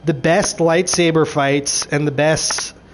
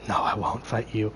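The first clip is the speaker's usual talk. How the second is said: low, very soft imitation; hushed retold refusal; 7 semitones lower, 11 dB quieter than usual. Saul refusing the witch's challenge, hushed and low. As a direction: drop down low and refuse quietly. Retelling Saul's refusal to fight.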